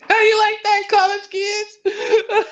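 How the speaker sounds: high, slow laughing taunt; mock taunting Dale. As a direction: jeer at the college kids.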